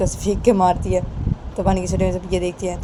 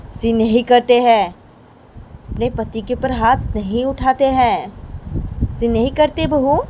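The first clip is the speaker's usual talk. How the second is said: slow, drawn-out raised scolding; admonishing elder; 6 semitones higher, 5 dB louder than usual. Acted. Chhoti Maa telling bahu not to hit her husband, firm scolding. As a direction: lecture her firmly, stretching the words.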